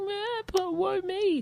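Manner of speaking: high, soft self-pitying whine; whiny voice. Retold or imitated. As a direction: moan it like a sulky patient. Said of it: "Last week he was all like".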